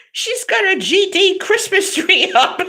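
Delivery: high, indignant imitation of an Italian dad, breaking into a laugh; hammed-up grumbling father; papa voice. Father ranting at the Christmas tree across the street, in a papa voice. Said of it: "I do the papa voice".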